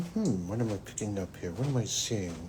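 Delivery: soft, low inner murmur; hushed, searching inner voice; quiet musing. Retold or imitated. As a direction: mutter it to yourself while sensing. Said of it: "So I can go into this story saying".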